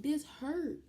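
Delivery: high strained complaint, voice going up; pained high cry. Retold or imitated. To the nurse pushing fluids, a high pained complaint. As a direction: squeak out that it hurts.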